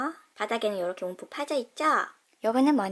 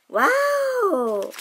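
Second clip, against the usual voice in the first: slow, high, loud "wow"; drawn-out wow; 8 semitones higher, 6 dB louder, much slower than usual. Real, not acted. Host admiring the tiger toy, drawn-out wow. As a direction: stretch the wow in awe.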